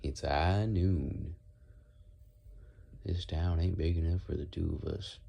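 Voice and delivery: drawn-out cowboy accent; Wild West accent. Shown as a demonstration. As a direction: play a Wild West gunslinger's drawl. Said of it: "And then Wild West".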